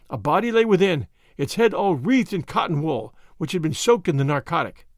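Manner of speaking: usual speaking voice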